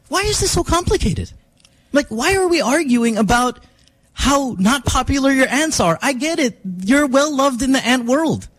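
high-pitched, mildly exasperated pleading; retold exasperation. Host retelling an argument about ants versus videos, exasperated. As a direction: plead the point as if reenacting a silly argument.

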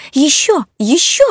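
high, loud ecstatic shriek; shrieking excitedly. A narrator's reading. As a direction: shriek bravo like a thrilled fan. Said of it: "кричала леди Кружева".

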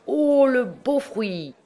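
high, slow exclamation of delight; drawn-out high-pitched whoop of admiration; exclaiming. A narrator's reading. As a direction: cry it out with mock wonder, stretched. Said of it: "l'écolier s'écria".

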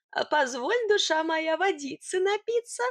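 coaxing, rising character voice; wheedling request. A narrator's reading. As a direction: sweet-talk her, lilting up at the end. Sidor sweet-talks her for water.

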